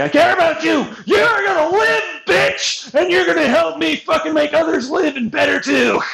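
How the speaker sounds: hammed-up tough-love yell; mock caring shout. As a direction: shout fake encouragement at them. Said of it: "It's like".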